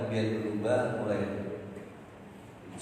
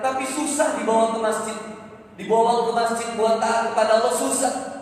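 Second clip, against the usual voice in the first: raised, ringing sermon voice, higher and louder; preaching; 11 semitones higher, 9 dB louder than usual. Acted. Preacher exhorting the congregation on lost property, full force. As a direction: preach it out to the crowd.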